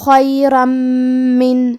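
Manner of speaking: خیرم with the tanween merged into میم under a held nasal ghunna; heavy خ, then a nasal hum into من; recited tajweed demo. Shown as a demonstration. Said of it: "غنہ اور تشدید کے ساتھ ادا کرنا ہے".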